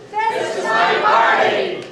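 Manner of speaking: high, loud and slow group yell. Pretend. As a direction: belt it out like a rally chant. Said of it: "Everybody yell out".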